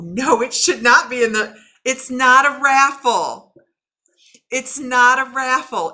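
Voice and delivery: loud, firm correction, dragged out slowly; insistent correction. Retold or imitated. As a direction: put your foot down on It's not a raffle. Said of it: "information that you go".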